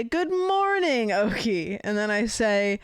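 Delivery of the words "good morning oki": high, slow sing-song good morning; a hint of a fancy waiter; cheery greeting. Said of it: "I will say".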